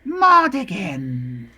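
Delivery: drawn-out eerie creature call; slow croaking were-raven cry. Acted. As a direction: stretch the name like a monster raven. GM voices raven calling name.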